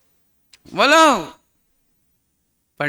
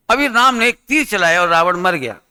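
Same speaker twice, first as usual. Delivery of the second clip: ordinary talk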